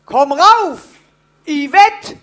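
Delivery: loud mock call to a child. Wife imagines calling their child in from the garden, shouting. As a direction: shout it up from the garden, name drawn out. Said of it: "Und die dann schreien".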